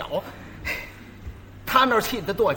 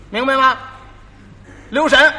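raised, higher stern master's warning; 6 semitones higher, 6 dB louder than usual. Retold or imitated. Master warning apprentice about poison bottles, loud and stern. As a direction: bark a sharp warning at the apprentice.

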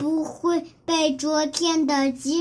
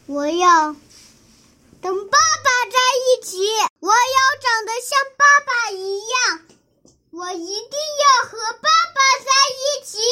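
higher, louder, fast wailing plea; tearful pleading; 7 semitones higher, 5 dB louder, faster than usual. Acted. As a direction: cry out through tears to stay. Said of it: "流下眼泪". (哭着高声哀求)

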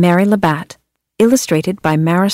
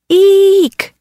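high loud squeal; thrilled shriek downhill; 12 semitones higher, 4 dB louder than usual. Acted. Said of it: "cried all the kids".

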